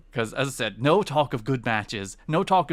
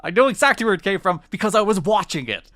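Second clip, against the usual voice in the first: much higher and louder, fed up; exasperated outburst; 9 semitones higher, 7 dB louder than usual. Real, not acted. Host venting at a Twitter critic, loud exasperated outburst. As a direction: snap back at the critic who corrected her.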